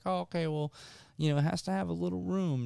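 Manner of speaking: high, put-on attendant voice; imitated ride attendant. Attendant explaining the lap bar, high imitated voice.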